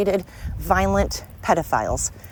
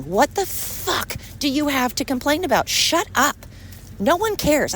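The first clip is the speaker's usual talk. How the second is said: higher, exasperated snap; fed up and lashing out; pissed-off outburst; 7 semitones higher than usual. Real, not acted. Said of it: "I'm really pissed off in this episode".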